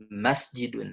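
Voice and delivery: MAS-jidun, falling ending; stress on first syllable. Shown as a demonstration. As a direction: hit "mas" first in masjidun. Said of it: "pelafalannya di huruf pertama".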